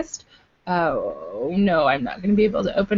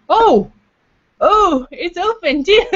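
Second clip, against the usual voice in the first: surprised; 9 semitones higher, 5 dB louder, slower than usual. Real, not acted. Beer can opens, surprise.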